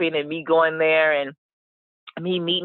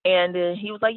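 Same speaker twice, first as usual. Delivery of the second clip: normal talking voice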